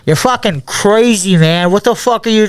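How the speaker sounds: imitated outraged complaint; indignant mock yell. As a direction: protest as the man who just got punched. Retold fight, man punched to the ground.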